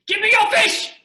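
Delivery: loud, high shouted demand. Acted. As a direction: bellow it, grabbing for the fish. Improv demand for fish.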